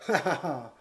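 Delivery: put-on heavy, low drunken laugh; drunken snigger. A narrator's reading. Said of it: "Il ricanait d'un pesant rire d'ivresse".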